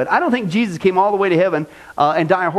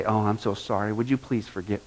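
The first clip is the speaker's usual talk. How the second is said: softer, sheepish mock apology; mock meek apology; 7 semitones lower, 9 dB quieter than usual. Pastor mocking how Christians apologize to critics, soft and sheepish. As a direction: grovel quietly to the critic, half in jest. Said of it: "what do we say".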